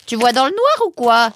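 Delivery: loud, high incredulous question; amazed. Acted. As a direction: marvel out loud at your companion. Amazed at companion's night vision.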